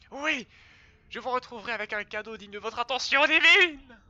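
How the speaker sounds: high, drawn-out adoring creature voice; fawning character voice. Acted. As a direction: grovel in awe before the hero. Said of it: "un peu de doublage".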